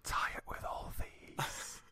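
slow breathy whisper; creepy whisper. Pretend. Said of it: "Anything becomes creepy if you whisper it".

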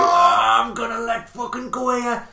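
loud, high, strained outburst; mock anger boiling over. Bill about to explode at Big Head, mock boiling over. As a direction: let it burst up from the toes. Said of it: "build all the way up to his face".

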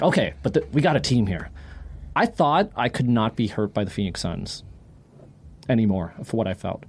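ordinary talk

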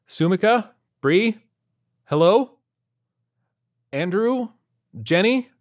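loud, high calling of names. Acted. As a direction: call each name out loudly. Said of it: "I called out".

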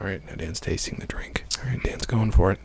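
hushed whispered play-by-play of the tasting. Acted. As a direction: whisper it like a golf commentator.

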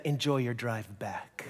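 a frosty goodbye; cold dismissal. Retold or imitated. Disgusted cashier sending the Canadian off, cold. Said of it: "she said".

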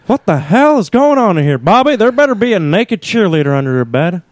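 high, exasperated cartoon dad; Hank Hill. Dad confronting Bobby in his room, high appalled voice. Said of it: "Dueling Hank Hills".

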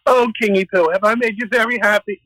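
high-pitched mock flattering Esther; coquettish act. Esther buttering up the tipsy king at her party, high-pitched put-on act. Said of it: "she puts on the same act".

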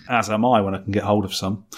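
ordinary talk, nothing marked

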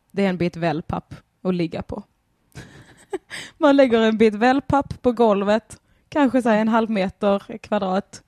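ordinary talk

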